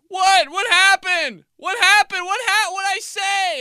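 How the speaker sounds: very high, loud confused dad; shrill panic at his own mistake; panicked dad. Retold or imitated. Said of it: "my dad was just like".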